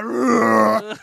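loud growling roar; tiger growl. Pretend. Tiger surprising kids in a bathroom, loud growl. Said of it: "He's like".

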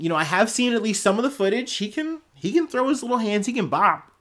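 fast, high teasing voice; high mocking voice. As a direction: talk him down, high and quick. Mocking the little overlord.

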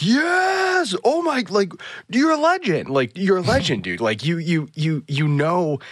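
high-pitched, gushing fan reenactment; thrilled fan outburst. Retold or imitated. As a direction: act out a whole group geeking out, starting on a big squeal and easing slightly. Group's thrilled reaction acted out.

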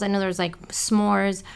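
ordinary delivery

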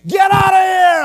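loud, high reenacted yell; screaming at her. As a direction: bellow it to drive her out. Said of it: "I'm screaming at her".

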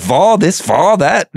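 high, loud mock British accent; terrible British accent. Mocking Charlie Austin's angry interview in a British accent. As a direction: ham up an angry British footballer. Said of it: "Terrible, terrible British accent".

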